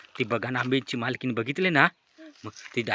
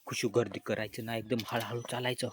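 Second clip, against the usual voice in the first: whispering while hiding; 2 semitones lower, 10 dB quieter than usual. Pretend. Sneaking up to steal mangoes.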